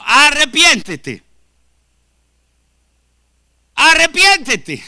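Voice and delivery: booming pulpit plea to repent; thundering call to repent. Acted. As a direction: command them to repent. Preacher calls to repentance.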